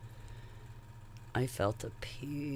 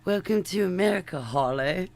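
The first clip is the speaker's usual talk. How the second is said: mock British welcome; 8 semitones higher, 8 dB louder than usual. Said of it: "nobody said".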